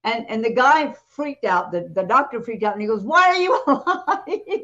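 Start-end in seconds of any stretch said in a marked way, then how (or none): 3.0-4.6 higher and louder, slow, laughing through it; laughing mock shock. The doctor gasping at the risen man, laughing.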